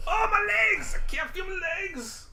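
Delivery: hammed-up wounded wail; shouting while wounded. Player's figure takes leg hit.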